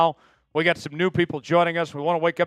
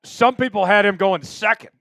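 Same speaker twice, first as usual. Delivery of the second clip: louder, higher hype over the pick; thrilled fan; 3 semitones higher, 6 dB louder than usual. Real, not acted. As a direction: celebrate the draft pick.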